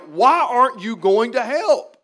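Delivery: loud, drawn-out exasperated plea; slow, emphatic, exasperated retelling; frustrated. Retold or imitated. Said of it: "she was frustrated".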